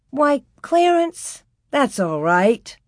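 high, drawn-out exclamation of surprise; surprised exclamation. A narrator's reading. Teacher to her apologizing pupil, exclaiming in surprise. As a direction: exclaim it slowly, starting high. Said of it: "Laura exclaimed".

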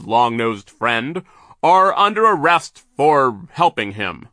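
Mister Poe reading charges to the villains, stern; stern arrest. Acted. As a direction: declare the charges gravely.

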